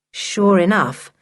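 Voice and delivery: ordinary delivery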